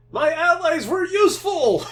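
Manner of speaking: loud, high victory yell; triumphant shout. Real, not acted. Gloating shout after a win.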